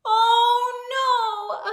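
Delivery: high loud dismayed cry. Acted. Reader reacting to popped button, high dismayed cry. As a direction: gasp it out, shocked.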